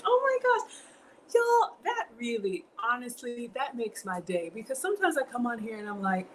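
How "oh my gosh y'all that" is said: very high, excited squeal; delighted squeal. Real, not acted. Touched that a viewer learned something, high delighted squeal. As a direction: shriek with delight.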